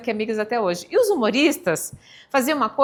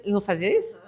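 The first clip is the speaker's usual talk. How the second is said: ordinary talk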